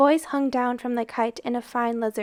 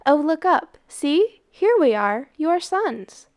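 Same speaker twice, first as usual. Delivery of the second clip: excited call; 4 semitones higher, 4 dB louder than usual. Acted. Boys call to town below.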